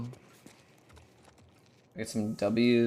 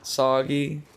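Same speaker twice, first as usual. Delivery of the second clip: ordinary delivery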